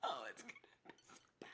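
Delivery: low, hushed whisper bracing for the cold; dreading whisper. Real, not acted. Dreading the icy socks.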